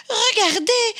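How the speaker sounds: a little bear who can't believe it; surprised exclamation. Acted. Baby Bear calling his parents, high and amazed. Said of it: "s'exclama Bébé Ours étonné".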